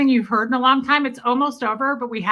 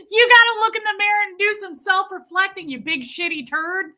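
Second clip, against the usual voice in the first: quick, higher mock rant; higher, faster imitation of the ranter; quoting the ranter; 6 semitones higher, faster than usual. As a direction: rattle it off like the angry guy, gleefully. Repeats the ranter's line.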